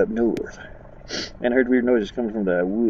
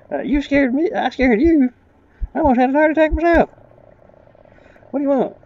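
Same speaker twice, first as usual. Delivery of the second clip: much higher voice for the startled stranger; 13 semitones higher than usual. Acted. Player voicing the stranger in a high pitch. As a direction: squeak the stranger's lines up high.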